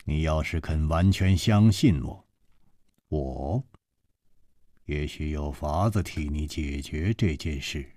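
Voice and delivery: calm her down softly, almost under the breath; gently. Acted. Chu Liuxiang comforting the weeping girl, low soft reassurance. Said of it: "楚留香柔声说".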